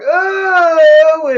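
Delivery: high, loud mock wail; startled cry. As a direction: imitate a caught kid crying out. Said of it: "Michael's like".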